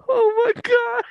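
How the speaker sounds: high, wheezing laugh-cry; crying with laughter. Real, not acted. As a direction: sob the words out laughing. Laughing hysterically at friend.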